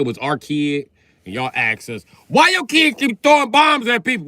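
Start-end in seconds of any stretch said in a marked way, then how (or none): 2.3-4.3 very high, loud questioning voice; hammed-up scandalized neighbor; mock outraged asker. Comic voicing someone confronting a parent, very high and loud. Parent asked about kid.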